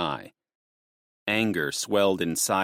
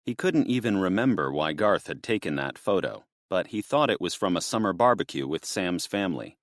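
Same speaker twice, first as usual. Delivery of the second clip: usual speaking voice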